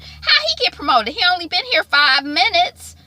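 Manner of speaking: high, incredulous outrage; indignant disbelief. Real, not acted. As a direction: ask it like it's ridiculous. Indignant aside about Leon.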